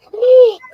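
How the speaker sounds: sudden loud high gasp of shock; sharp gasp. Retold or imitated. The girlfriend sees the beaten inmate, a loud gasp. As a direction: suck in air in horror. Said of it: "you can hear her sucking wind".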